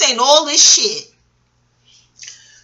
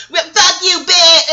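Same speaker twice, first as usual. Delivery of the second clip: louder, higher snapped insult; mock insult; 5 semitones higher, 4 dB louder, slower than usual. Stranger at bus stop swearing at her, mock loud snap. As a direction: fire the last word back, half joking.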